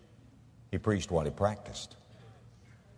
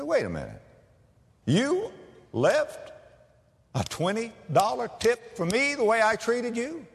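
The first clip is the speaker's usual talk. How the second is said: astonished; 11 semitones higher than usual. Retold or imitated. Waitress astonished by big tip.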